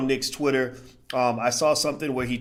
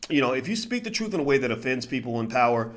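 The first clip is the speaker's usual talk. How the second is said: ordinary talk, nothing marked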